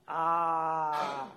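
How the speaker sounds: long held ah at the doctor; open-mouth ah. A narrator's reading. Whale showing the crab doctor its throat, drawn-out ah.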